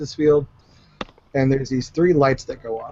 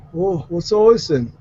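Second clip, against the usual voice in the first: lightly voiced big guy, higher and louder; 5 semitones higher, 5 dB louder than usual. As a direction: play a big guy's surprised greeting, lightly. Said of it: "there's this big guy".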